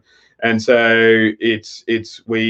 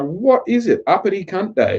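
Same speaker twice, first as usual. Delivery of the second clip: exasperated; 10 semitones higher than usual. Retold or imitated. Retelling exasperated phone call home.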